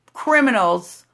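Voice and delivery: normal talking voice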